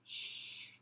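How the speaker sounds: soft breathy shush to quiet the class; teacher quieting chatty kids in class; teacher's shush. Acted. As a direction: hush the room with a long shh. Missus Glass puts a finger to her mouth.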